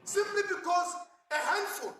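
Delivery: usual speaking voice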